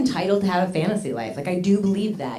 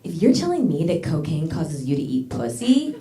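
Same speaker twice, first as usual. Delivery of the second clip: building to a higher, louder mock outrage; indignant comeback; 5 semitones higher, 4 dB louder than usual. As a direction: lay into him with sarcastic fury. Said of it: "And I was like".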